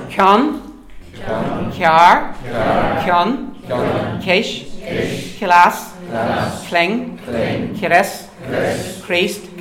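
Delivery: loud K words, each stressed; K word drill. Shown as a demonstration. Teacher drilling a list of K words. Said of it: "So, Fang the".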